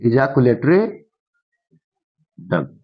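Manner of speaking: ejaculatory, a long gap, then a dropped duct; slow dictation. Shown as a demonstration. Teacher dictates term with pauses.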